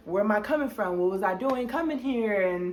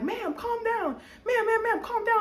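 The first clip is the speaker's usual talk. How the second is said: officer calming her; 13 semitones higher than usual. Retold or imitated. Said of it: "He's like".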